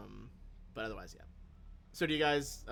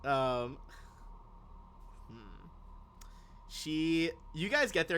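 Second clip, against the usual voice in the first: normal talking voice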